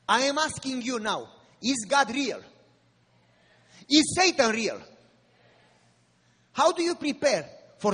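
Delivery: sermon preaching. Acted. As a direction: call the flock to readiness.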